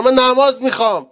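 loud, high cry of longing. Shown as a demonstration. Preacher crying out his longing for prayer, loud. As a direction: cry out the word نماز with full force, high and loud. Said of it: "whispered repeat after it".

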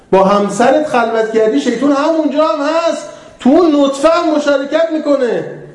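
high, pressing preacher's voice; rising sermon fervour. Acted. Preacher warning believers about Satan at home, fervent. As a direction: drive the point home to the hall.

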